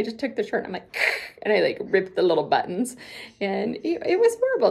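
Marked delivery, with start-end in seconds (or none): 3.8-4.7 high, loud exclaimed horror; dramatic regret. Real, not acted. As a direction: cry out how awful it was. Regret over ripped buttons.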